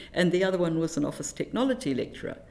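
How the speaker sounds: ordinary delivery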